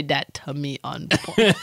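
low, slow monster voice with a laugh in it; Cookie Monster. Retold or imitated. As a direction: playfully drop your pitch and drag the words. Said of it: "like your Cookie Monster jukebox".

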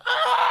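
high, loud squeal of delight; one sharp squeal of joy; excited squeal. Retold or imitated. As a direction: squeal like she just got a new car. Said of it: "you know, like".

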